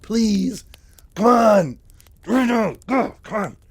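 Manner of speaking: high, loud, hammed-up begging; mock pleading. Mike begging for the smokes.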